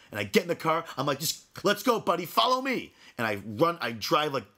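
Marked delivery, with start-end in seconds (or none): 1.2-2.9 high, urgent goading; pitched-up retold road-rage shout without full volume; raged-out challenge. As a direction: re-enact daring a rival to follow. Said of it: "now he knows I'm raged out".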